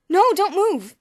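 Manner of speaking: loud, high urgent cry; cried out. A narrator's reading. Boots crying out to stop George getting up. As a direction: stop him with a sharp cry. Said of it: "Boots cried".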